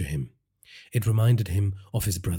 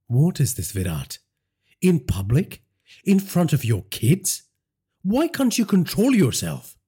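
angry confrontation; 8 semitones higher, 3 dB louder than usual. Acted. Wife confronts husband.

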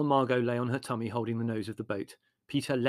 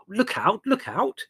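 high, loud warning cry; remonstrating; 11 semitones higher, 4 dB louder, slower than usual. A narrator's reading. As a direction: call out in sharp protest. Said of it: "he remonstrated".

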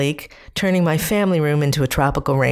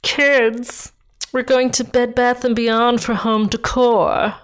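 put-on higher, jaw-clenched, upper-crust accent; Larchmont Lockjaw; 8 semitones higher, slower than usual. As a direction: talk through clenched teeth like old-money Westchester. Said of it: "Larchmont Lockjaw".

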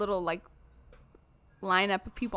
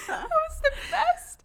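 squeaky, high voice through laughter; laughing. Real, not acted. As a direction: barely get it out for laughing. Cracking up at the scene.

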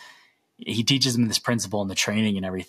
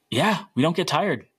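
bright, higher mock boast to the runners; cheery boast; 8 semitones higher, 3 dB louder than usual. Retelling Jesse telling runners he never tires, upbeat mock boast. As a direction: sell it with a grin, pitched up.

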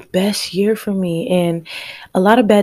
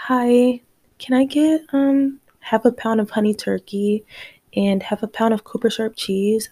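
high, soft deli-counter voice; lighter, higher ordering voice; polite customer ordering; 5 semitones higher, 4 dB quieter than usual. Retold or imitated. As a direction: re-enact a sweet, higher-pitched food order. Acting out her deli order.

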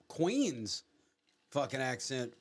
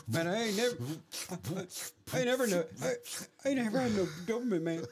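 stoner quote; 7 semitones higher, much faster than usual. Retold or imitated. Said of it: "quote movie lines from Cheech and Chong".

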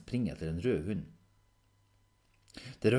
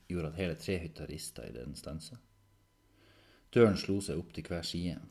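usual speaking voice

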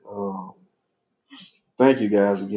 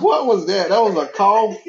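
amused disbelief; 11 semitones higher, 3 dB louder than usual. Real, not acted. Mock disbelief at a noise.

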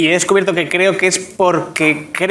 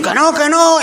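high protest; 8 semitones higher than usual. Pretend. Son protesting to mother.

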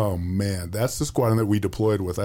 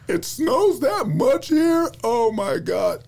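imitating wife's dismay; 4 dB louder, slower than usual. Wife shocked by snow news.

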